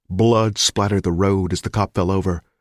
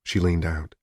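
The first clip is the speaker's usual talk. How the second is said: normal talking voice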